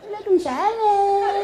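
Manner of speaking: high, slow, hammed-up sob; mock crying. Girl ignored for two days, mock crying on the phone. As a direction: wail the greeting like a jilted girl. Girl answers neglected boyfriend's call.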